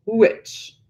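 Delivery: a hint of a sullen old monster; murmuring monster. A narrator's reading. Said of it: "the monster murmured".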